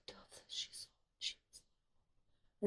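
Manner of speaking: soft hushed whisper; awed whisper. Real, not acted. Connecting the dove memory, whispering. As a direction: whisper the revelation.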